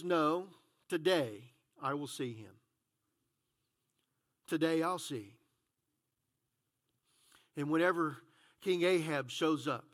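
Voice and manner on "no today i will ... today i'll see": Elijah's reply. Acted. Elijah answers Ahab, quoted low.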